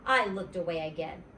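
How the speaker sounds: usual speaking voice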